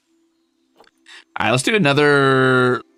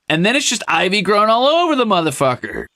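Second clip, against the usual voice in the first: mock-aggressive, higher and punchy; 7 semitones higher than usual. Real, not acted. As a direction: bark it like you're angry at the pillar. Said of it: "I said that really aggressively".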